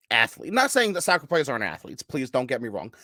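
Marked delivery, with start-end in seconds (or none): none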